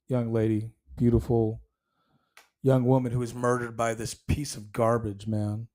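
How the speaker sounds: hushed and grieving; somber. Real, not acted. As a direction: let it sink in quietly. Mourning a murdered young woman.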